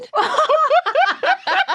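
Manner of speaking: helpless high-pitched laughing; loud, high cackling laugh; high burst of laughter. Real, not acted.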